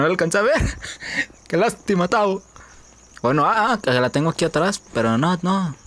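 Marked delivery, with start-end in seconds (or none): none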